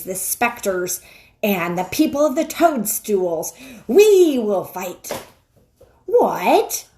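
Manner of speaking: loud, imperious witch barking orders; a queen rallying monsters, loud and high; commanding witch. Acted. Witch orders the wolf to summon her army, imperious.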